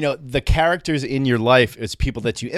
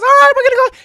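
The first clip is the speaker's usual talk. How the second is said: much higher and much louder, manic squeal; John the wired boss; 11 dB louder, much faster than usual. Retold or imitated. Coked-up landscaping boss greeting the twelve-year-old, shrieking high and loud. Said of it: "And he goes".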